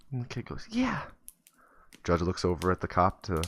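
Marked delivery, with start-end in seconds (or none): 0.7-1.0 very high, small "Yeah"; little kid voice. Acted. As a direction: answer timidly like a tiny boy. Boy answers the judge.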